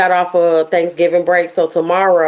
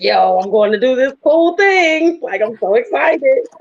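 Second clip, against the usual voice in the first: excited; 5 semitones higher than usual. Retold or imitated. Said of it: "so they were excited for me".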